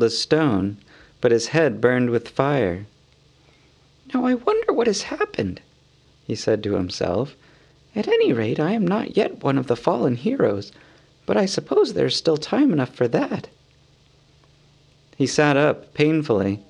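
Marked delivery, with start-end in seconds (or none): 4.1-13.5 high, light hobbit voice, dazed and wry; a dazed hobbit musing to himself; Bilbo's voice. Acted. Dazed Bilbo muses alone on the hillside, higher hobbit voice.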